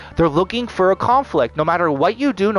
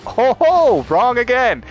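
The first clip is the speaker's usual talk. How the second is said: high, loud sing-song taunt; sarcastic ho ho, wrong again; mock gloating; 9 semitones higher, 6 dB louder, slower than usual. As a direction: gloat like a smug jester at yourself. Mocking own mistaken hope.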